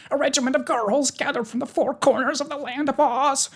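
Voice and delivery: high-pitched frightened report; fearful soldier. A narrator's reading. Soldier reporting the regiment to his king, fearful. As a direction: report the enemy in a high, alarmed voice. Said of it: "still pale with fear".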